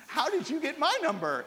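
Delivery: lightly acted incredulous question, high-pitched; a touch of acted bewilderment; mock bafflement. Acted baffled reply to caller.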